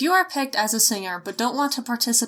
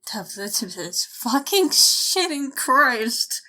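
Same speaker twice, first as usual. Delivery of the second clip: slow drawn-out curse; much slower than usual. Real, not acted. After another flub, she drags out a weary curse. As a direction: stretch each word in defeat.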